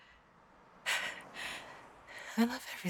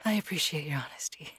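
whispering; 4 semitones lower, faster than usual. Acted. Whispered, hurt reply.

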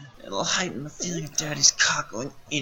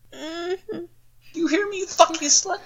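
much higher, drawn-out raging yell; unhinged scream; 4 dB louder, much slower than usual. Acted. Father screams his threat at the tied-up captive, unhinged. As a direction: scream it at her, losing control.

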